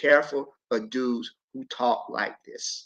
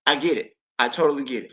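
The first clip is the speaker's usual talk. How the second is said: ordinary talk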